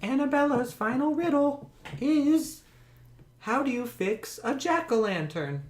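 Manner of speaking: put-on high, soft girl voice; Annabella's high-pitched, softly spoken voice; little girl riddler. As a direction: pose the riddle in a high girlish voice.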